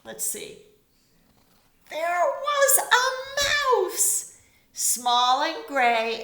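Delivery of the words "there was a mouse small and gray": much higher, stretched and louder; slow storybook refrain. A narrator's reading. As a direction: sing out the surprise refrain slowly.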